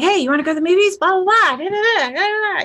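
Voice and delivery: lightly mimicked good mood; mock cheerful chatter. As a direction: rattle it off upbeat. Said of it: "he's in a great mood".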